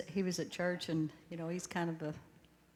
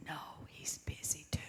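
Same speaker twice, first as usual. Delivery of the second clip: whisper; 3 semitones lower, 9 dB quieter than usual. Retold or imitated. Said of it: "he whispered when he answered".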